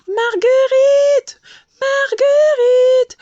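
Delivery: high, loud, drawn-out call. A narrator's reading. The worried lady searching from room to room, calling the queen's name. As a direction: call her name across the hive. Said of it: "en criant".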